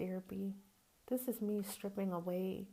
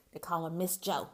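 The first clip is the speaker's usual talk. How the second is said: usual speaking voice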